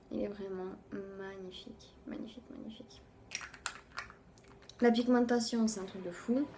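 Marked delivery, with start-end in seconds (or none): 0.1-3.0 fading from soft praise into a whisper; a hushed, delighted whisper of admiration; awed whisper. Real, not acted. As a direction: breathe the repeated magnifique almost silently.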